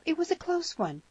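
put-on high-pitched, eager girl; excited girlish encouragement; girl's cry. A narrator's reading. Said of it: "cried the girl".

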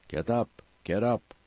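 an understated shout from a boatman; urgent order, only lightly shouted. A narrator's reading. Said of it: "shouted the man".

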